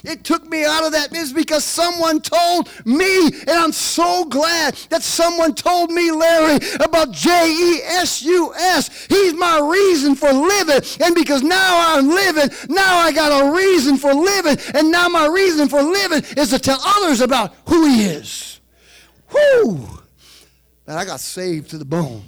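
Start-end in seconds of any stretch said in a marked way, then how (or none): 0.0-18.6 preaching at full force. Acted. 19.3-19.8 exhausted whew. Real, not acted. After preaching climax.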